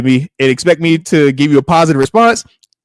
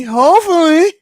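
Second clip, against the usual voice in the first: "hopefully" said higher and louder with a rising tune; hopeful lift; 12 semitones higher, 5 dB louder, slower than usual. Shown as a demonstration. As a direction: push "hopefully" up high, hoping hard.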